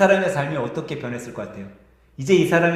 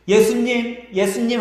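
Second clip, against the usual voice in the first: calling out; 6 semitones higher, slower than usual. A narrator's reading. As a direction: call to Jesus as you run. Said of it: "하면서 소리치는 거예요". (멀리서 부르며 외치는 소리)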